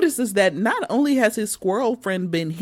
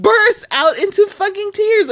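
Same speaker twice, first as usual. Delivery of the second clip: ordinary delivery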